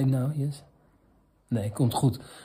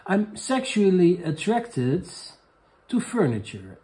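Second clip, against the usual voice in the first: slow dramatic reveal; 5 semitones higher, slower than usual. Acted. Reading letter's dramatic confession.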